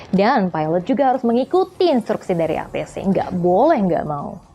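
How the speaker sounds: usual speaking voice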